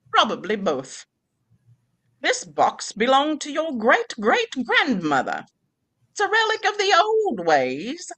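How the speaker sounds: lightly voiced old grandmother; grandma voice. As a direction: read it as a wry old grandma. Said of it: "You just did with the grandma".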